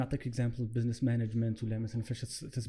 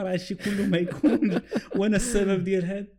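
laughing; 6 semitones higher, 6 dB louder than usual. Real, not acted. Mocking human self-importance, laughing.

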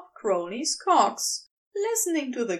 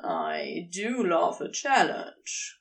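Crowley voice; 6 semitones lower, slower than usual. A narrator's reading. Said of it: "Crowley says".

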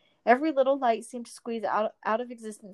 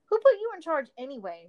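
moaning; 6 semitones higher than usual. Acted. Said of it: "Mirabella moaned".

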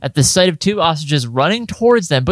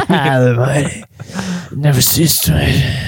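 breathy murmur. Acted.

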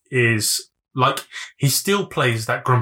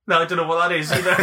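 usual speaking voice

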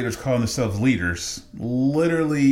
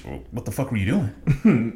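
ordinary talk

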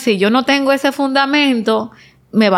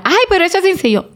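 dismissive quote; 9 semitones higher, much faster than usual. Retold or imitated. Mocking a dismissive listener.

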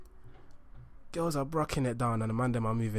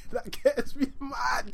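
laughing rant; 10 semitones higher, much slower than usual. Real, not acted. Laughing while ranting.

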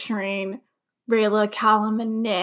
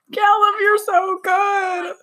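much higher, slow, half-crying shout of joy; teary excited cry; 10 semitones higher, slower than usual. Real, not acted. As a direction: cry it out, overwhelmed with love. Emotional fan reaction.

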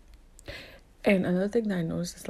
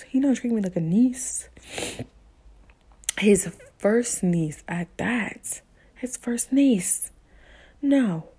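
louder and higher, slow emphatic words; wounded outrage, real; hurt indignation; 5 semitones higher, 5 dB louder than usual. Real, not acted. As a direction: let the anger about him spill out. Venting about uncle's treatment.

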